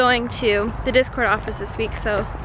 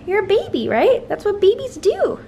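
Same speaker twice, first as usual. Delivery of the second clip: high, doting coo; 8 semitones higher than usual. Real, not acted. Owner soothing her teething puppy, high coo.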